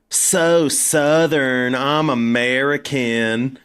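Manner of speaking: exaggerated Southern American drawl, very slow; mimicked American accent. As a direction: drag it out like a caricatured Southerner. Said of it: "to mimic an American accent".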